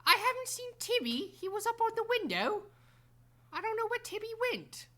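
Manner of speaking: put-on high, wavering old lady voice; a lost, fretting old woman calling for her cat; dotty absent-minded old lady. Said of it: "slightly hear a woman being like".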